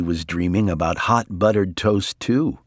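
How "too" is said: usual speaking voice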